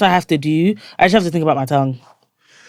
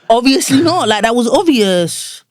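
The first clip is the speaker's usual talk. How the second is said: high, loud sarcastic echo of the friends; mock disbelief; 7 semitones higher, 4 dB louder, slower than usual. Mocking the friends' careless answer, high and loud. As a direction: throw their words back in scorn.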